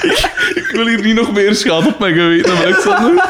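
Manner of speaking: high voice, breaking into laughter; laughing protest. Real, not acted. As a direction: plead while trying not to crack up. Protests amid helpless laughter. (hoog protest, proestend van het lachen)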